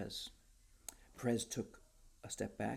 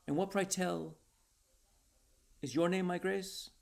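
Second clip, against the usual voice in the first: called out higher and louder; calling after; 8 semitones higher, 6 dB louder than usual. A narrator's reading. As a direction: call after a departing man. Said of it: "Perez called after him".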